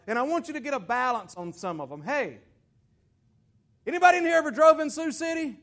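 ordinary talk, nothing marked

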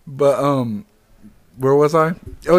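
usual speaking voice

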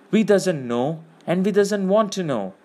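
whimpering. A narrator's reading. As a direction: whimper it in character, pitch lifting into a protest. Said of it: "he whimpered".

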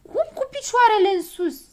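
disbelieving cry; loud astonished cry from the little men; amazed. A narrator's reading. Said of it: "se mirară toți piticii".

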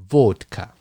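'vodka' with the stress pulled to the front; Russian stress in vodka. Shown as a demonstration. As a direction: say 'vodka' the Russian way, weight on VOD. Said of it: "所以就要念成".

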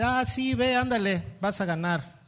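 mock-eager high sarcasm; sarcastic prophet. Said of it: "no seas sarcástico".

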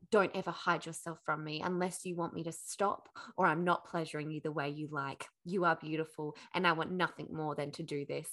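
low, soft seductive command; intimate lover prince. Acted. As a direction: murmur it low, as a devoted lover. Voices lover's tender, commanding declaration.

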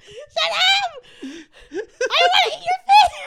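high, loud laughing "shut up"; laughing shout. Retold or imitated. Coworker shrieking back through laughter at the teasing. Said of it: "She's like".

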